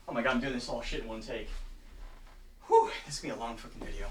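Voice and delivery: tired grumble under the breath; muttered aside. Real, not acted.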